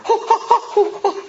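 high, loud scornful ho-ho laughter; mocking laugh. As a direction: jeer like someone laughing at a failure. Mocking reply instead of well done.

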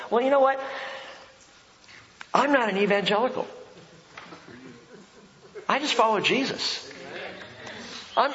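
ordinary talk

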